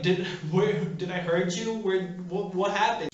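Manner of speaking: worried rushed questions; alarmed, asking if she's hurt; panicked. Retold or imitated. Panicked apology to injured actress.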